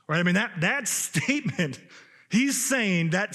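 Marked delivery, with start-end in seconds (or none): none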